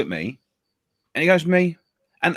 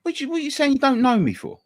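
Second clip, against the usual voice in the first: high-pitched, affronted mock challenge; aggressive; 11 semitones higher than usual. Taxi driver challenged for being unknown, imitated aggressive indignation. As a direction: imitate a stranger taking offence. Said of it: "he's quite, quite aggressive".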